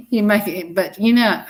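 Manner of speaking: normal talking voice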